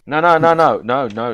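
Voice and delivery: loud, high repeated no; emphatic protest. Real, not acted. Rejecting the other speaker.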